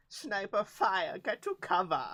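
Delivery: drawn-out German soldier's alarm; German accent. Acted. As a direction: call it out in a hammed-up German accent. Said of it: "The fuck is that accent?".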